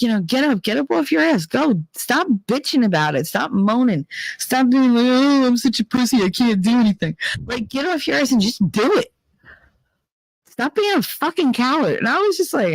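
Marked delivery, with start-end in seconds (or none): 0.0-5.1 high, hammed-up tough-love rant; mock rant telling people to get up; imitating Nick's rant. 5.1-7.2 high, mocking self-pity; hammed-up mock whimper; whiny mock voice. 7.5-12.0 high, scolding mock rant; tough-love scolding voice; imitating Nick's rant. Quoting Nick's rant at people.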